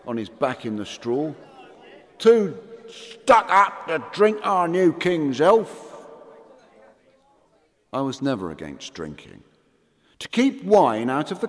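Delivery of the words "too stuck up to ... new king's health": slow, high drunken mockery. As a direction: sneer it, slurring. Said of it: "hiccuped the drunk".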